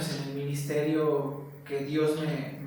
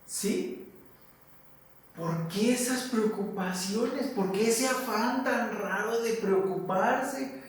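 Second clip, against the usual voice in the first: put-on a loving father chiding his worried children; preacher lightly voicing God reassuring his children; 4 semitones higher than usual. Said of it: "yo me imagino a Dios como diciendo".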